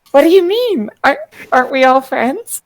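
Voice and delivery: high, sweetly puzzled protest; feigned innocence. Acted. [name] answers talk about restraint, feigning innocence. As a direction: play the innocent, pitched well up.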